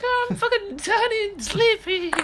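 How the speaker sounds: high, put-on mock voice; mocking Shauna. Teasing the absent league player, high mock voice. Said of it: "But in a Nordy accent".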